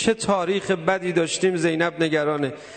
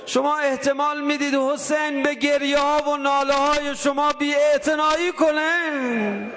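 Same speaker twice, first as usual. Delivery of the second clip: raised, sustained high-pitched appeal; impassioned preaching; 5 semitones higher than usual. Real, not acted. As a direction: plead with the congregation, drawing out the final word. (موعظهٔ پرشور با صدای زیر و کشیده)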